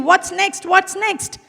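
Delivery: high, whiny mock of a child pestering; mocking a greedy child. Mother scolding her son, mimicking his greedy whine. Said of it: "you can't keep saying".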